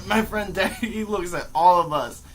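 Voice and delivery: ordinary delivery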